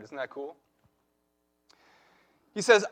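ordinary delivery

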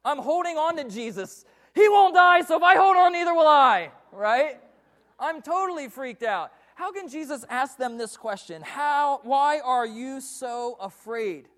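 loud, high, frantic panic, slowing at the end; cling on in terror in the storm; panicked disciples. Acted. Fisherman in the sinking boat, shouting in panic.

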